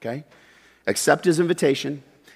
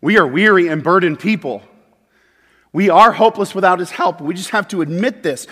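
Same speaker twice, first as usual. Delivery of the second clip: louder, higher preaching push; preaching at full force; 7 semitones higher, 6 dB louder than usual. Acted. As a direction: drive it home to the congregation.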